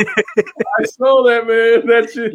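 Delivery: slow, laughing through the words; real laughter breaking into speech. Real, not acted. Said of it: "that had me dying".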